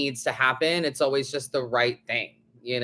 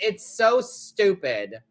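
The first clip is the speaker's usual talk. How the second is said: ordinary talk, nothing marked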